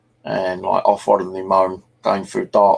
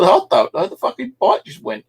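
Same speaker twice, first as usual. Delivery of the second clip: high, flustered retold protest; imitating his younger self's panicked excuse; exasperated excuse; 11 semitones higher than usual. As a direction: squeal out the excuse. Defending himself to angry parents.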